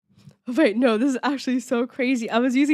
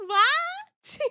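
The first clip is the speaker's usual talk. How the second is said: a high, squeaky "what?"; shocked squeal; 3 dB louder than usual. Real, not acted. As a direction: squeak it out in surprise. Reacts to chat reveal.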